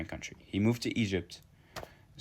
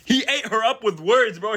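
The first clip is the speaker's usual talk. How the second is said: high and loud, hyped up; storyteller hyping the poet's charm to viewers, excited; 11 semitones higher, 5 dB louder than usual. Real, not acted. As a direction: gush like a fan. Hyping up the poet.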